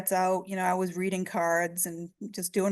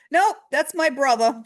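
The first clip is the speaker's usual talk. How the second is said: emphatic; 6 semitones higher, 6 dB louder than usual. Retold or imitated. Daughter quick correction.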